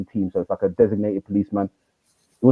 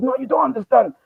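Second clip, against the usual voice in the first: much higher, pleading insistence; agitated teen. Retold or imitated. Teen insisting the teacher doesn't understand. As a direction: voice him urgent and raw. Boy insists he'll retaliate.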